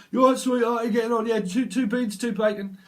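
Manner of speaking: hammed-up British banter, loud and high; British accent. Tarot reader mimicking British small talk, hammed-up British accent. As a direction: call it out in a mock British accent. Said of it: "Yeah, that's British accent".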